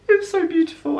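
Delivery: high-pitched gush of admiration. Real, not acted. Vlogger admiring the new phone, gushing high.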